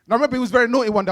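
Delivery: ordinary talk, nothing marked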